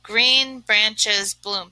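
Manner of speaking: "Green branches bloom" very slow, syllable by syllable; slow syllable-counting read. Shown as a demonstration. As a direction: tap out each syllable slowly. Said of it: "That's only four syllables".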